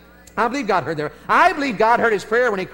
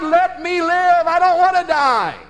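high, loud, slow pleading cry; desperate cry to God; 10 semitones higher, 5 dB louder, slower than usual. Retold or imitated. Veteran retelling his battlefield plea, high desperate cry. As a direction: beg God for your life in the foxhole.

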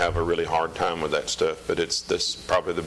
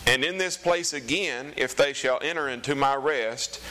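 scripture reading; 5 semitones higher than usual. Acted. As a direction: read it out like the pulpit. Reads scripture aloud.

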